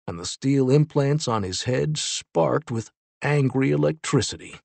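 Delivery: ordinary delivery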